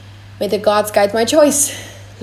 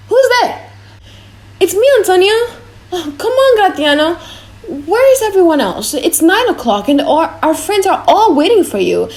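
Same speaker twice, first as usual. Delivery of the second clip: much higher, louder, calling out in the night; urgent raised voice in the dark street; 8 semitones higher, 4 dB louder than usual. Acted. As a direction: hail him urgently across the dark. Night call, urgent greeting.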